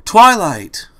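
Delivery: frantic shout. A narrator's reading. Dash calling for missing Twilight in the library, shouting. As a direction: yell her name into the wreckage.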